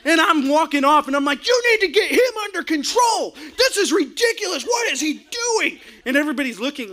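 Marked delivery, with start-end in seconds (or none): 1.4-5.8 high-pitched angry yelling; furious shout. Retold or imitated. Coach shouting at officials while led off court, furious. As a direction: demand they control the ref.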